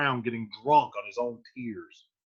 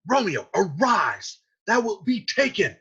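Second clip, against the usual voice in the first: urgent urging; 5 semitones higher, 4 dB louder than usual. Acted. Friar urges Romeo to get up.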